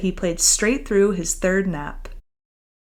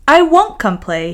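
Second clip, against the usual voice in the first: refusing; 5 semitones higher, 5 dB louder than usual. Acted. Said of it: "he replied".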